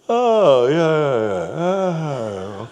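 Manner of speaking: loud, drawn-out mock realization; crusty man conceding. Man remembers bells at church, loud dawning agreement. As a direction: start high and loud, then come down.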